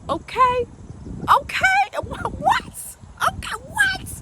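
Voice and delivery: very high, hammed-up squeals; mock amazement. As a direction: squeal each word like you can't believe it. Hammed-up amazement over old snacks.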